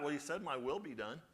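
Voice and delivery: usual speaking voice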